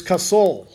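"Kasol" with weight on the second syllable; Hebrew pronunciation. Shown as a demonstration. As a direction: say "Kasol" as kuh-SOHL. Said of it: "is actually pronounced very similar".

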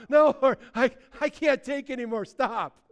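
high, loud, mock-overwhelmed plea; overwhelmed protest. Believer shown wonders by God in heaven, hammed-up overwhelmed begging. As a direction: beg for a halt in delighted overload.

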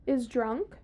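ordinary delivery

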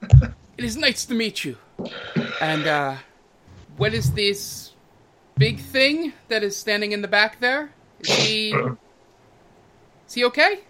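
a hint of the slick host, curious; smarmy half-elf host. Cranor sizing up the party's big companion, lightly oily.

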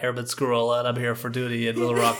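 eager nerdy rookie reporting for duty; mock your naive younger self in a nerdy voice; nerdy young airman. Man playing himself as a new airman arriving in Arkansas, nerdy. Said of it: "That is me circa two thousand seven".

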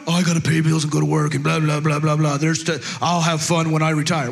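low droning grumble; mock dreary adult. Pastor mocking adults putting off fun for bills, low drone. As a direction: drone through it low, like a weary grown-up. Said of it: "Stop being so adultish".

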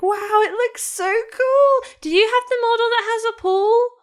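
put-on a boy gazing at the spaceship; awestruck kid.

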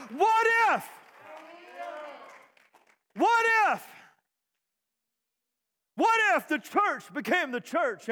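loud, high, rousing sermon delivery; full-force preaching. Real, not acted. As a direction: preach it to the whole church, punching each what-if.

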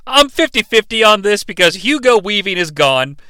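normal talking voice

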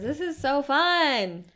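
high, loud, slow sing-song imitation; mock Lala. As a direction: stretch it out like a delighted party girl. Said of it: "Lala says".

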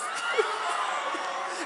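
high fake sob; hammed-up weeping burst; mock sobbing. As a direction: sob theatrically. Acted sobbing between pleas.

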